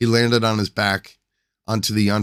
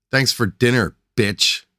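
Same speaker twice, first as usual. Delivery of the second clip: higher, louder sneering call; yelled; 5 semitones higher, 5 dB louder than usual. Acted. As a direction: yell it from the stairs. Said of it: "he yelled into the kitchen".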